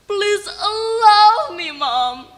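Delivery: tearful high plea, slowed right down; pleading. Acted. Son begging his mother to let him go, tearful plea. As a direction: beg her with a cry in the voice.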